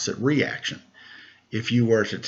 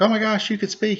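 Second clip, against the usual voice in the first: amazed mom; 13 semitones higher than usual. Retold or imitated. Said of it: "she was like".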